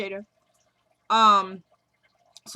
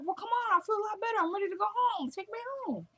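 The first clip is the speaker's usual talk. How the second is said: put-on very high, pleading child voice; Quincy Junior; 13 semitones higher, faster than usual. Hospitalized boy urging Grandma to take him home, high child voice. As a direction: imitate a small boy begging to leave. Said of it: "she sees Quincy Junior".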